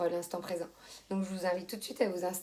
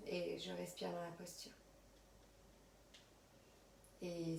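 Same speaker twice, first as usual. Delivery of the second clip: soft, unhurried yoga nidra voice with long pauses; guided relaxation; 12 dB quieter than usual. Acted. Yoga teacher settling students on their mats, soft guided relaxation. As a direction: guide them quietly through body and breath.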